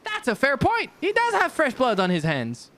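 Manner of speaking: high, cheerful sidekick voice; high character voice. Acted. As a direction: chirp it up high.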